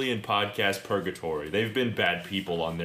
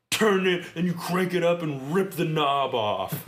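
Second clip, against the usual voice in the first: mock wild; 7 semitones higher, 3 dB louder than usual. Mock cranking the volume up.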